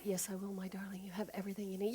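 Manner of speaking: tender whisper; soft loving whisper; whispered reassurance. Acted. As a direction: breathe it like a secret. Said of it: "a softer, quieter voice".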